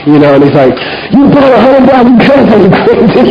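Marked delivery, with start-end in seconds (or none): 1.1-3.3 high-pitched mock outrage at the employee; angry boss. Boss scolding the sorter over carats, high mock anger. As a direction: play the boss bursting in, higher and indignant. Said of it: "he's, like, very angry".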